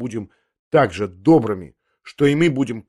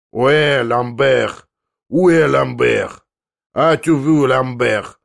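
loud, drawn-out bellowed call; wild cry; 5 dB louder, much slower than usual. Acted. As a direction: cry out wildly to someone inside from the street. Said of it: "раздался дикий крик его с улицы".

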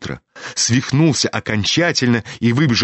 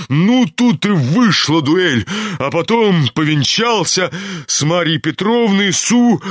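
higher, agitated storytelling in character; impassioned old general; 8 semitones higher than usual. A narrator's reading. General tells his son about a duel, impassioned. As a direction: declaim the war tale with fervour.

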